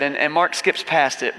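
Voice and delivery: normal talking voice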